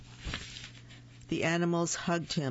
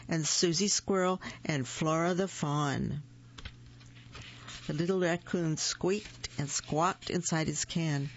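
plain reading voice

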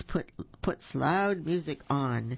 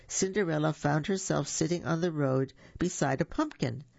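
plain reading voice

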